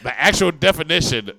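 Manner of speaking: loud and high, rising; excited. Real, not acted. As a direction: exclaim it loudly.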